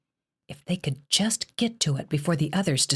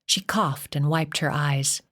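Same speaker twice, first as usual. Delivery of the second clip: ordinary delivery, nothing marked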